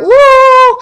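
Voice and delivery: high, loud whooping cheer; sudden giddy whoop; tipsy party whoop; drunken woo. Retold or imitated.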